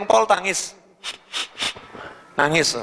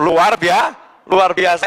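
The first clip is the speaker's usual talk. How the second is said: ordinary talk, nothing marked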